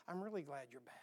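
hushed and loving, welcoming them home; a quiet, tender I'm really glad you're back; tender welcome. Retold or imitated.

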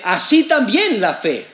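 very slow, weighty verse read aloud; solemn slow scripture reading. Acted. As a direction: let each phrase land with solemn pauses. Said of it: "Porque dice".

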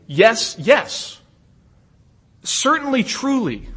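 each word set apart and stressed, "Certainly" pitched high; emphatic affirmation. Acted. Preacher voicing John's bilingual affirmation, emphatic on every word. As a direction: underscore "Yes, yes", then lift "Certainly" and "truly". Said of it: "another strong emphatic yes".

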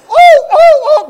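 high barking yelps, dog imitation. Guard dog barking at a thief at two in the morning, high yelps.

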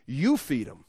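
strong stress on the opening "You"; emphatic YOU. Shown as a demonstration. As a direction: punch "You" to put the job on them. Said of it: "emphatically, it's".

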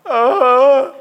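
put-on tearful boy. Crying boy answers.

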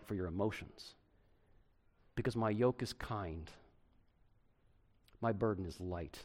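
a light touch of tender invitation, soft; gentle, tender Jesus. Acted. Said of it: "listen to how he describes himself".

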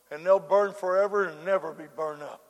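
ordinary talk, nothing marked

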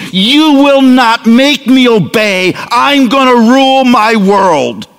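high, drawn-out declaration of defiance; defiant child. Acted. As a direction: dig in like a child refusing to obey. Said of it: "shocking rebellion against God".

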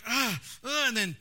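high, mock-frustrated cries; exasperated driver yelping at the bug guts; frustrated yelps. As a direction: yelp at the bugs in mock frustration. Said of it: "And I'm going".

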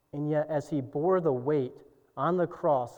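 normal talking voice

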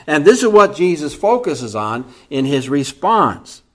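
normal talking voice

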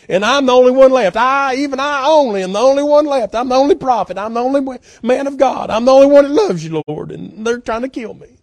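high-pitched, insistent lament to God; down, self-pitying complaint. Acted. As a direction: pile up the grievances in mock despair. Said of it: "he's really gotten down about this".

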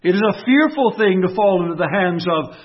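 fervent preacher's call; full-force preaching. Acted. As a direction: thunder it from the pulpit.

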